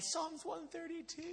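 high, soft weepy praying voice; imitated weeping. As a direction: mimic a tearful prayer, thin and high-pitched. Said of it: "this weepy praying man".

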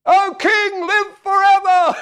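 very high, loud, drawn-out holler; hammed-up calling back. Daniel answers the king, hammed-up high call. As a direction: shout up from the pit in a comic high voice.

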